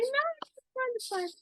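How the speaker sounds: high reassuring. Retold or imitated. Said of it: "I was like".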